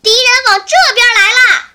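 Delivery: loud high alarmed call; boy's shout. Acted. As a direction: cry out the warning to the others. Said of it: "雨来喊了一声". (大声喊叫报信)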